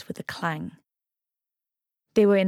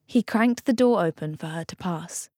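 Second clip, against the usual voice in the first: ordinary delivery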